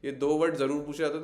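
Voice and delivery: normal talking voice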